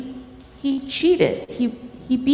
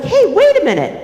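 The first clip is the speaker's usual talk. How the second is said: higher-pitched startled objection; indignant turtle; 7 semitones higher, 4 dB louder, faster than usual. Acted. Storyteller voices Turtle objecting to the newcomer, indignant. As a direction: protest in a raised turtle voice. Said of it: "Turtle's like".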